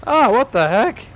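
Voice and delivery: loud, high startled cry; shocked outburst. Real, not acted. Streamer seeing the luggage burn, shocked loud cry. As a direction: blurt it in disbelief.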